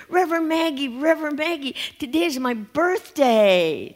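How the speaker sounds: put-on Johnny running up to the minister, eager child voice; excited little boy. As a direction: call out like a small boy bursting with news. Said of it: "Two minutes later".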